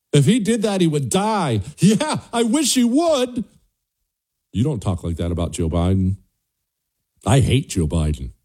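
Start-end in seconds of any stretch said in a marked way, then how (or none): 0.1-3.4 high-pitched mock of political opponents' chatter; mocking Democrat impersonation. Host parroting how Democrats talk about a rival, mocking high voice. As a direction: mimic them in a raised, put-on voice, drawing out the wish. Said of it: "That's how they talk".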